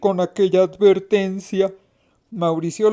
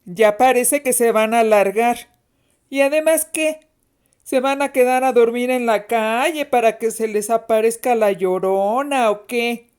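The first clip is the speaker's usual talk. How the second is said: put-on scoffing older-sister voice; teasing, put-on sneer at little siblings; taunting big sister; 2 semitones higher than usual. A narrator's reading. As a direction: dare them with mocking disbelief.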